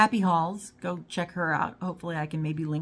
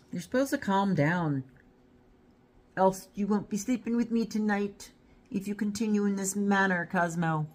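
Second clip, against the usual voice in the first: raised-pitch warning to a pet; mock scolding; 4 semitones higher than usual. Real, not acted. Owner warns Cosmo about sleeping with her tonight, mock scolding. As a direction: lightly chide a misbehaving dog.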